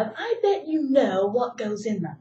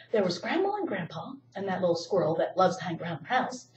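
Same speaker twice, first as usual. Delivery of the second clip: usual speaking voice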